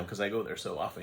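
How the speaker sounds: ordinary talk, nothing marked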